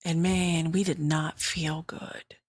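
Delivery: normal talking voice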